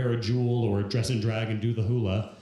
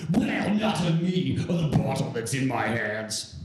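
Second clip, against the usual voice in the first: stand-in impression voice. The ex confessing by text about the bottle, put-on wailing voice. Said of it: "so that's the voice".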